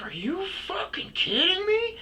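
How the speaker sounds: high shrill outraged woman's voice; angry shriek. Retold or imitated. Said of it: "she was like".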